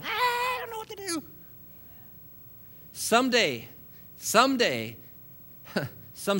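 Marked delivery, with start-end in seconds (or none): 0.0-1.2 high-pitched squealing panic; mock stark terror. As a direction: squeal it like a devil fleeing in terror. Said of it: "running away in stark terror".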